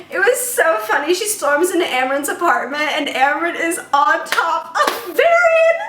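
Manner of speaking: high-pitched, squealing storytelling; gleeful excitement. Real, not acted. Reviewer recounting an embarrassing walk-in scene, giddy and high.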